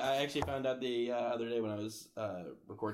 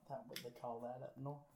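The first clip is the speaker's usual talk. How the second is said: put-on soft molasses-mouthed Southern accent; Southern belle; 12 dB quieter than usual. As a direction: re-enact the Southern belle, quietly. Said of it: "you got molasses in your mouth".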